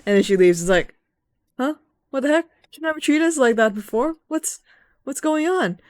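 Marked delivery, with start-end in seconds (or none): 1.6-5.8 high, puzzled mock voice; confused companions asking what just happened; baffled. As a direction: sound stunned at her outburst. Retold companions baffled by sylph.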